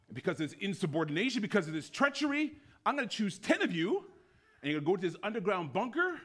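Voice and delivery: high, loud outraged tirade; irate commandant. Acted. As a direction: pronounce sentence on the prisoners in fury. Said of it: "the commandant is irate".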